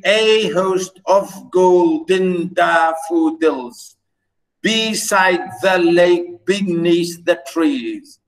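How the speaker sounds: slow, evenly stretched syllables; exaggerated, measured metrical reading; syllable-by-syllable scansion. Shown as a demonstration. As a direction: recite the verse slowly, beat by beat. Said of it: "we do it according to syllables".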